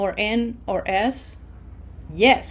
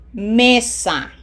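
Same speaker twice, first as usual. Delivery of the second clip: MEsa, louder first syllable; stress on first syllable; 2 semitones higher, 6 dB louder than usual. Shown as a demonstration. Teacher models Mesa for learners, stress on ME. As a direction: punch the ME in Mesa. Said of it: "emphasis is in syllable number two".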